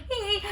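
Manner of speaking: short high-pitched "hee"; nervous squeal. Retold or imitated. Grandchild showing how scared Nan makes them, nervous squeal. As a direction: let out a little high squeak.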